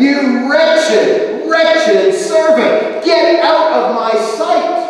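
loud, high, drawn-out denunciation; booming angry rebuke, stretched slow; thundering master. Acted. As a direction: thunder at a worthless servant. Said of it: "his master thunders at him".

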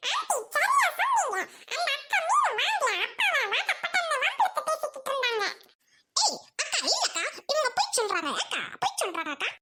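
put-on tattling child voice. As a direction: tell on her like a little boy. Child telling on the woman. (அக்காவைப் பற்றி புகார் சொல்லும் சிறுவன் குரல்)

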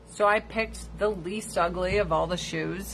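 usual speaking voice

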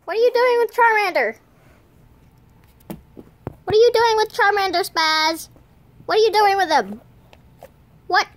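high, loud, rapid-fire demand; mock scolding yell. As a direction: play at yelling an accusing question. Acted scolding, yelling at someone.

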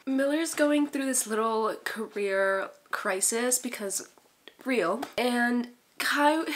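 usual speaking voice

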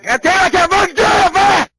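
screaming in frustration, very high and loud; furious yell at the song; frustrated shout. Real, not acted. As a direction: yell at yourself for failing. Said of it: "my voice started to hurt".

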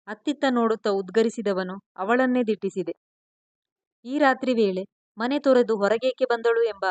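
ordinary delivery, nothing marked

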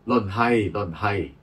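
slow, measured verse reading with pauses between lines; classical poem recitation. Acted. Sharing selected prison poems with listeners, solemn poem recitation. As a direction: recite line by line, each opening strongly and falling away.

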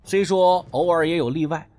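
ordinary delivery

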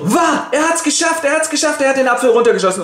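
high breathless cheering, speeding up; excited cheer. Acted. Onlookers cheer that Tell hit the apple, high excited cheer. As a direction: burst out high and fast with joy. Crowd cheers after shot.

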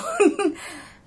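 loud, high amused laugh; high burst of laughter. Real, not acted. Vlogger laughing at talking to her cat, loud high laugh. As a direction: break into a real laugh at your own joke.

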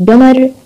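قمر with a hard g for the qaf; dialect variant. Shown as a demonstration. Teacher showing a dialect, قمر with a g sound. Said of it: "هاي حسب اللهجات".

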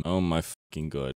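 ordinary talk

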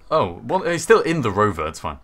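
usual speaking voice

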